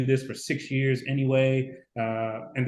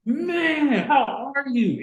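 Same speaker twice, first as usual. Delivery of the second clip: much higher, delighted greeting; excited hello; 11 semitones higher, much slower than usual. Retold or imitated. Catching up with his old recruiter, excited high greeting. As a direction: beam at someone you haven't seen in years.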